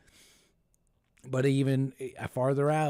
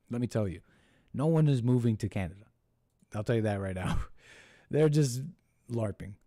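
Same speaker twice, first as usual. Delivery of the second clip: normal talking voice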